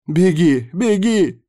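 high, loud, gleeful urging; joyful yell. A narrator's reading. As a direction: cheer the runner on like a zealot. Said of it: "радостно завопил сектант".

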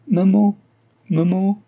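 high, loud cry for mother. A narrator's reading. Girl cries out for her dying mother, loud and high. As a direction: call out for her mother in panic. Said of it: "cria Denise".

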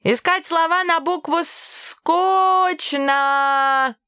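put-on high-pitched whiny little boy; upset child. A narrator's reading. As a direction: protest like a frustrated kid, stretching the last word. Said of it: "колотя руками и ногами по ковру от огорчения".

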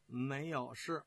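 usual speaking voice

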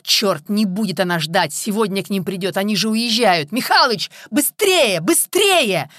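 from tense cursing up to high shouting; frantic urging. A narrator's reading. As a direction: build to a loud, high yell at the driver. Boss urging driver, escalating.

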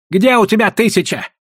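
high, demanding question; challenging shout. A narrator's reading. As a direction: call out the bluff. Challenge to a gambler's bet.

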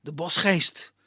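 usual speaking voice

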